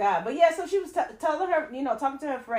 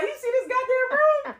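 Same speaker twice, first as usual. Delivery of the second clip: high excited bragging; 12 semitones higher than usual. Retold or imitated. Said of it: "She spun around".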